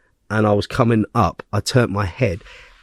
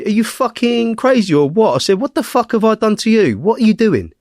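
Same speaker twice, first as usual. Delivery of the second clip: high-pitched indignant re-enactment; outraged confrontation; 9 semitones higher than usual. Retold or imitated. Retelling how he challenged his attacker, outraged confrontation. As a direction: raise the pitch right up, retelling an angry challenge.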